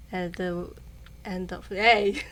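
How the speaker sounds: usual speaking voice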